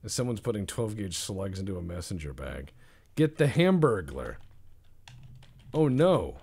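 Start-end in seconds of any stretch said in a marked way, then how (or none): 3.2-6.4 mock alarm. Mock alarm at burger thief.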